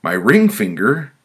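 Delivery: high, loud incredulous question; alarmed disbelief. Acted. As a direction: echo it back in alarm, rising. Victim's alarmed question to the committee.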